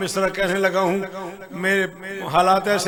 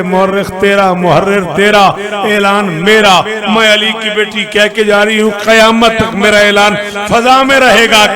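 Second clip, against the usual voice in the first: full-force recitation; 3 semitones higher, 16 dB louder than usual. Acted.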